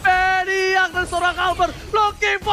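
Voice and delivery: fighting game announcer. Real, not acted. Caster hypes a multi-kill streak.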